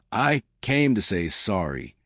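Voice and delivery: plain reading voice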